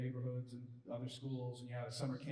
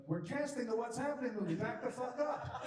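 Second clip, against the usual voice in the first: laughing; 11 semitones higher, 5 dB louder than usual. Retold or imitated. Joking retort to the crew.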